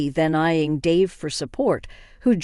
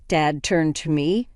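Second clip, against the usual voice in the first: ordinary delivery, nothing marked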